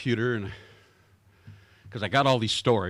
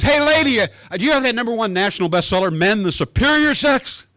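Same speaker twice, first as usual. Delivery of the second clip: high, loud cocky customer; lightly voiced know-it-all guy; joke customer; 8 semitones higher, 7 dB louder than usual. Joke character asks bookstore lady.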